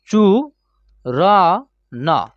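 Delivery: चू र न split into separate slow syllables. Shown as a demonstration. Teacher spelling the word out syllable by syllable for students. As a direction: break चूरन apart, one syllable at a time. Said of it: "चूरन".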